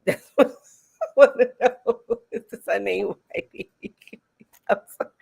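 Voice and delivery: giggling through the question; slowed, laughter-soaked question; laughing. Real, not acted. Asking the viewers while laughing, real giggles. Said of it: "I'm so weak right now".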